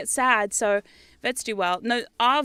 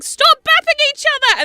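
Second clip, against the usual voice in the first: much higher, loud yell; 10 dB louder than usual. Retold or imitated. Owner yelling at fighting cats, high and loud. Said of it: "I'm like".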